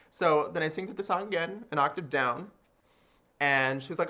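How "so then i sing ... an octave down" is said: normal talking voice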